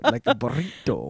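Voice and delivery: a hint of rolled R's on burrito; lightly put-on Spanish accent; mock Spanish accent. Shown as a demonstration. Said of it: "do you roll your R's".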